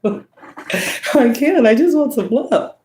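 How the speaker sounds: put-on high, pleading child's voice; daughter's voice. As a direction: whine it like a little girl wanting love. Mother voicing her daughter's reply.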